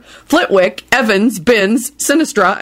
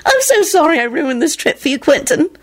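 laughing through tears; 13 semitones higher than usual. Acted. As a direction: laugh and sob at once. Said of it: "shrieking with laughter".

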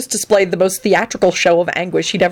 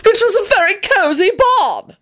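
very high, huffy retort; indignant defence. Acted. Antonin defends his barn to Benji, high and indignant. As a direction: insist on it in high-pitched indignation.

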